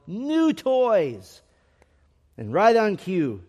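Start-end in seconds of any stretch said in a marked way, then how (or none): 0.1-1.4 high, drawn-out happy announcement yell; storyteller's high-pitched yell, not full volume; narrated yell. A narrator's reading. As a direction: call it out high like a big reveal. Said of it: "and yelled".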